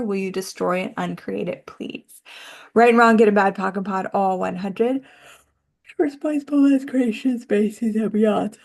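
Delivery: quick ritual chant; rapid recitation. Acted. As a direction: rattle off the clearing formula. Recites the clearing statement.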